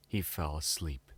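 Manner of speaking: ordinary delivery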